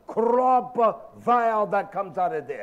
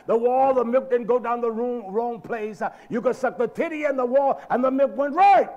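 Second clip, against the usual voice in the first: louder preacher's cadence with hard punches; emphatic preaching; 3 semitones higher, 5 dB louder than usual. Real, not acted. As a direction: preach it with rising force. Preaching at full force.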